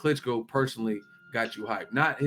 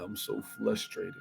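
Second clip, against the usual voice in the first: soft mock country boxer; lightly voiced, quiet country mispronunciation; Wilder impression; 7 dB quieter than usual. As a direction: say "frustrated" with an L, flustrated. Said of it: "He be like".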